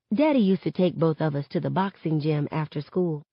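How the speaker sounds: plain reading voice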